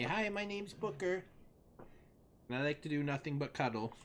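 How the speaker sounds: high-pitched dog voice; cute high pet voice, quick at the end; Booker. Acted. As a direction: speak for your cuddly pup, up high. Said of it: "You wanna say hi to everyone?".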